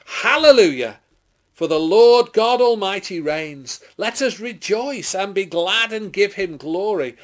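high, loud cry of praise; jubilant shout. A narrator's reading. Great multitude rejoicing at the Lamb's wedding, jubilant shout. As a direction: roar it out like a multitude.

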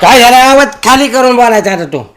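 loud angry rebuke; angry scolding. Acted. Husband turns the tables and scolds his wife loudly. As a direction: put her in her place, loudly.

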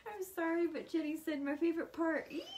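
high, excited squeal of delight. Real, not acted. Excited over viewer's comment.

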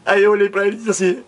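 high, mock-sobbing storytelling; tearful narration. Man recalls answering his father, hammed-up crying. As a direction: keep telling it through put-on tears.

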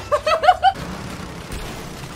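long high-pitched laughing fit; real helpless laughter; high cackling laugh. Real, not acted. Laughing while pushing past players.

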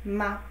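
Ma said soft and light; neutral tone, softly. Shown as a demonstration. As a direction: let Ma slip out gently. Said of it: "Just kinda softly".

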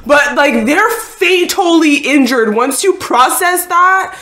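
loud, high, insistent argument; exasperated pleading. Real, not acted. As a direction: plead loudly with someone who won't listen. Pleading angrily with the character.

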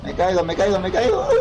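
panicking as the car falls; rising panic. Real, not acted.

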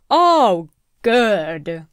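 ordinary talk, nothing marked

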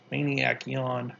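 usual speaking voice

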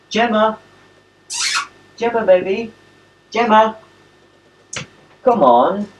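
high-pitched pet call; calling a dog. Real, not acted.

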